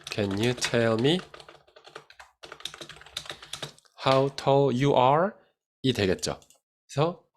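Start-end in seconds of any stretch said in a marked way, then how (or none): 0.1-5.3 slow dictation. Shown as a demonstration. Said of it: "이건 어떻게 하죠?".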